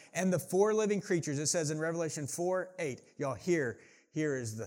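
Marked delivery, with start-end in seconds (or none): 0.1-1.4 raised, louder scripture proclamation; proclaiming Bible reading. Acted. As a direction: read the verse out to the congregation with force. Starts reading scripture aloud.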